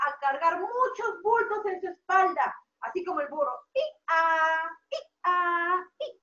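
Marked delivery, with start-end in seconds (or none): 3.7-6.1 imitated hee-haw; mock donkey braying for kids. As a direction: bray like a loaded donkey.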